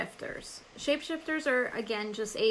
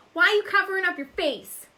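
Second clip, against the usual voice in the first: teasing shout; 9 semitones higher, 11 dB louder than usual. Real, not acted. Teasing a friend hiding face.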